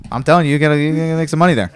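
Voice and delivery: loud, pushing the point; emphatic. Real, not acted. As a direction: hype it up. Urging loudly about money.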